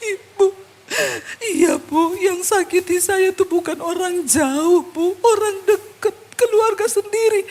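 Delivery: high, slow sobbing wail; imitated crying. Wounded woman confessing to pastor, imitated tearful wail. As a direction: wail the words through mock sobs.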